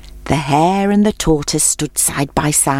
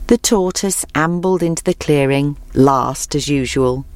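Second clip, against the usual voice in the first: usual speaking voice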